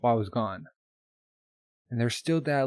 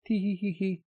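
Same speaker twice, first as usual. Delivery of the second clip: mock giggle; 7 semitones higher than usual. Playful giggle voiced by the teller.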